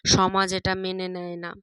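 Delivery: ordinary delivery, nothing marked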